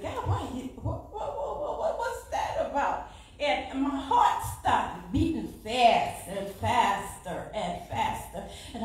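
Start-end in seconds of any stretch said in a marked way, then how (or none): 0.0-3.1 high, stammering what-what with laughter; preacher retelling her confusion to God; bewildered question. Retold or imitated. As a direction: sputter out the whats in disbelief. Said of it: "I said".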